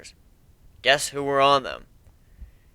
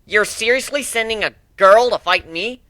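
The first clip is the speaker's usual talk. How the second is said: higher, taunting jeer; guard taunts the pirates for sending a girl; mocking; 8 semitones higher, 4 dB louder than usual. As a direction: sneer at the pirates, playground mockery. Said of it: "the guard mocked".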